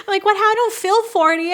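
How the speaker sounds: high-pitched, disbelieving protest; amused incredulity. Real, not acted. Vlogger who doesn't feel forty, amused incredulity.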